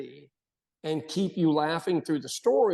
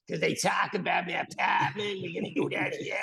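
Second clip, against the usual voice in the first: put-on Boston accent. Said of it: "I've never spoken like that".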